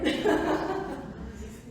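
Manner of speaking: breathy chuckling burst; real laugh. Real, not acted. Speaker amused by the acted slip, laughing. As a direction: break into laughter after the joke.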